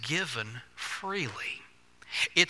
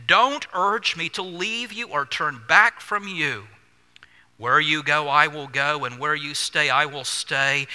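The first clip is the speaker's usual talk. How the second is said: loud, emphatic declaimed vow; solemn vow read out at full voice; scripture proclamation; 8 dB louder than usual. Acted. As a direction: proclaim it to the congregation, weighting each clause. Reads scripture out with force.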